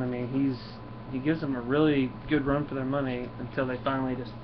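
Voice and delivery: usual speaking voice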